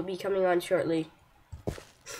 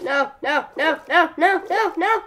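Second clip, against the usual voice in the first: rising panicked no; 7 semitones higher, 6 dB louder than usual. Real, not acted. Losing items.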